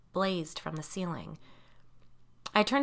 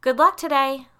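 loud, high call from upstairs; projected, raised farewell; 8 semitones higher, 12 dB louder than usual. A narrator's reading. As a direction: call a send-off down the stairs. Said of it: "Laura called down from upstairs".

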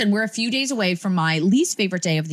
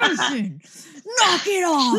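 yelling; 10 semitones higher than usual. Retold or imitated. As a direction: snap the warning at a kid. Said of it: "she finally did it".

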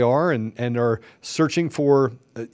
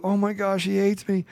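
pleading parent; 7 semitones higher than usual. Pretend. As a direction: beg like a hurt parent.

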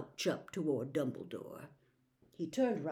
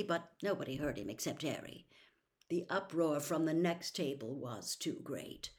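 usual speaking voice

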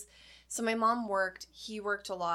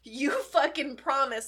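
mock outrage; 3 semitones higher, 6 dB louder than usual. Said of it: "I was so like".

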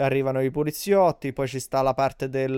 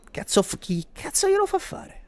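exasperated high outburst; 13 semitones higher, 3 dB quieter than usual. Real, not acted. Exasperated outburst at gangster life.

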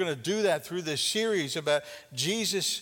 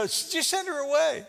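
"Just send her away" pitched higher, brushed off; impatient disciples; 9 semitones higher, 3 dB louder than usual. Retold or imitated. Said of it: "They go".

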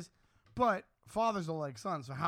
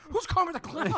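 put-on much higher scared kid question; 11 semitones higher, 5 dB louder than usual. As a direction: ask it like a spooked child. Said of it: "I was like".

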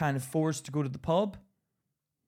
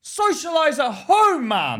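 higher, louder, slow insistence; emphatic retort; 8 semitones higher, 6 dB louder, slower than usual. Real, not acted. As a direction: drag the words out to win the point. Arguing with Luke about pubs.

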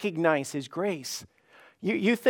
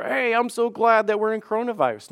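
higher, chirpy mock rejoicing; falsely cheerful, pitched up; mock gladness; 5 semitones higher, 4 dB louder than usual. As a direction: act out misplaced cheer about bad news. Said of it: "God's not saying you need to just rejoice".

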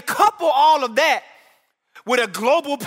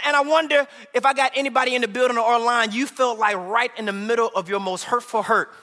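normal talking voice

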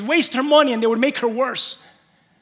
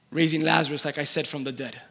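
ordinary delivery

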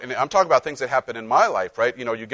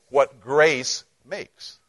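"what grace makes" drawn out very slowly; slow punchline; much slower than usual. Shown as a demonstration. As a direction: stretch every word of the payoff. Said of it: "say this with me".

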